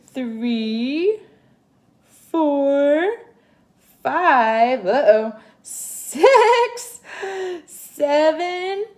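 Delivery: excited counting to eight; building count. Acted. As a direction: build the count to a big finish. Counting legs with building suspense.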